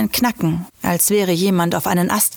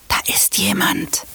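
tense low whisper, breath only; whispering while hiding; 12 semitones lower than usual. A narrator's reading. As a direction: whisper it so nobody hears. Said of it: "flüsterte ich".

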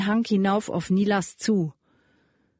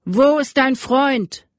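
interrogating demand; 5 semitones higher, 6 dB louder, slower than usual. A narrator's reading. Rider interrogates the captive boy.

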